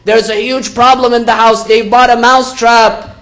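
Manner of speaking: high, loud cry for help; acted yell. Mouse runs to the goat for help, acted yell. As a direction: raise pitch and volume, urgently calling for help. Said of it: "He begins to yell".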